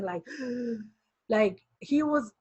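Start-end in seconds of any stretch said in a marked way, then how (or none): 0.3-0.8 soft, sharp imitated gasp; imitated shocked breathing. Imitating the director who could not believe it, gasp. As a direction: mimic his stunned intake of breath. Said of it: "I can hear him breathing".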